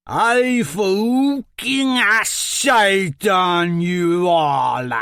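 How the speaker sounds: put-on very slow, loud ogre roar in a thick accent; bellowing ogre. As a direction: roar the insult at the whole arena like a brute. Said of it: "he bellowed in his thick Ogul accent".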